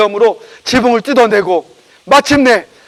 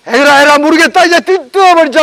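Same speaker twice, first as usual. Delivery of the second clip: raised, louder what-the-heck cry; higher, louder give-up-and-go-for-it call; reckless resolve; 5 semitones higher, 4 dB louder than usual. Acted. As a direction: throw caution aside and shout it. Friends give in, decide recklessly.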